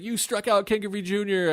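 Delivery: high choked-up congratulation; tearful joy. Retold or imitated. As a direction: imitate a brother crying with pride. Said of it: "He's like".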